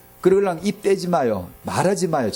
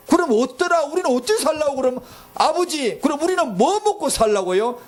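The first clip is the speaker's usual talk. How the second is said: whining complaint; 8 semitones higher than usual. Acted. Congregation complaining to God, acted.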